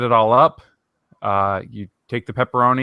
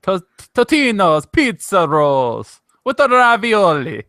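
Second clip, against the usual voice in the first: slow, hammed-up Italian accent, pitched up; slow, exaggerated mock Italian accent, raised pitch; 6 semitones higher, 4 dB louder, slower than usual. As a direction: ham it up like a cartoon Italian pizza chef. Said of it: "How racist can we get this episode?".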